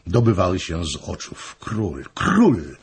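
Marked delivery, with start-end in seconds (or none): 1.6-2.8 dazed, absent repetition; stunned, automatic murmur; mechanically repeated. Acted. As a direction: say it numbly, as if not hearing yourself. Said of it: "powtarzał machinalnie".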